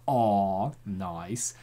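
normal talking voice

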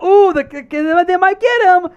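high, loud excited mimicry; excited fan imitation. Host imitating a hopeful Mavs fan, excited. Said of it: "got himself excited about".

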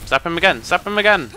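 excited battle cry; urgent cheering on. Real, not acted. Urging another zap in a fight.

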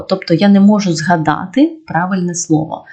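normal talking voice

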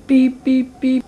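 loud, even electronic beeping; imitated computer alert beeps. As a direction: beep like an alarm going off. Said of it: "It's the Bat computer".

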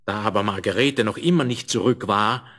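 ordinary delivery, nothing marked

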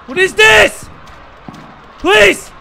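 loud, high panicked plea; shouted, high-pitched distress; frightened outcry. Real, not acted. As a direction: cry out as if begging for it to stop. Frightened pleading at the game.

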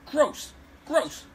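high, sharp cry of disgust; repeated high yelps of disgust. Real, not acted. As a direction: spit the word out in revulsion. Disgust at rival's shelter.

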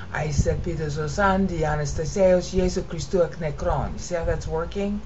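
normal talking voice

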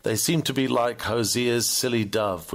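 ordinary delivery

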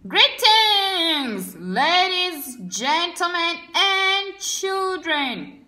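loud, high formal greeting; governor's speech. Acted. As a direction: address the crowd grandly. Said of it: "began Governor Gray".

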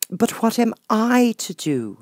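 Alice. Acted. Alice pleads.

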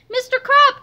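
high, loud cry; calling out. A narrator's reading. Harold spotting their missing principal, crying out. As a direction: cry out the name. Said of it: "cried Harold".

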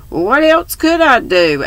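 high-pitched, mildly upset protest; much disturbed. A narrator's reading. Girl defending herself to angry friend, disturbed. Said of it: "asked Polly, much disturbed".

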